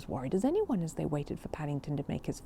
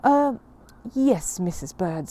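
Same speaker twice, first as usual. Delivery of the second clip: slow, halting, politely hiding distaste; polite hesitant bear; 6 dB louder, slower than usual. Acted. Paddington answering Mrs Bird about her meringue, politely hesitant. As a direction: stall for time, too polite to complain. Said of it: "said Paddington politely".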